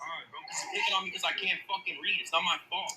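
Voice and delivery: loud, heated protest; real angry yelling. Real, not acted. Man yelling at his friend for mocking his reading, furious. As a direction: shout back in real anger at being picked on. Angry outburst at being mocked.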